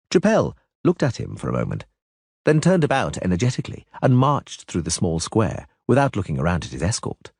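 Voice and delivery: plain reading voice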